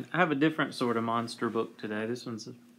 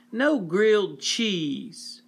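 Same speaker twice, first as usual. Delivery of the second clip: high, slow whine; whining child; 6 semitones higher, slower than usual. Acted. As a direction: complain like a spoiled kid. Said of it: "whined Eve".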